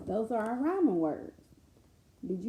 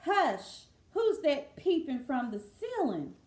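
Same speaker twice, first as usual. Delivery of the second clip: louder rhythmic storybook chant; lilting read-aloud refrain; sing-song lullaby reading; 6 semitones higher, 6 dB louder than usual. Acted.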